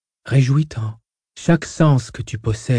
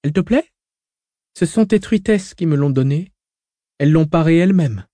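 higher, light teasing woman; young woman voice; 6 semitones higher, 3 dB louder than usual. Acted. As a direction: voice Hui playful and light. Hui shows her dress.

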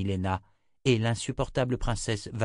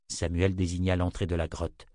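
ordinary delivery

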